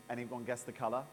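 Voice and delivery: usual speaking voice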